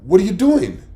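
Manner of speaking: loud, high, startled question; baffled question. Retold or imitated. Husband baffled as his wife packs up mid-meal, loud and high. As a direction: ask it like she's lost her mind. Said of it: "And I'm like".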